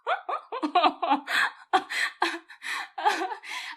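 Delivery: loud real laughter; laughing. Real, not acted. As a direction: laugh at your own blunder. Laughing at the story.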